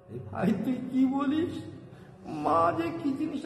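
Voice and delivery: put-on high, pleading old woman's voice; lightly voiced grandmother asking in disbelief; grandmother's reply. Grandmother answering the child in high disbelief. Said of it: "আমি সে বুঝলাম".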